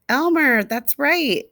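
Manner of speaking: plain reading voice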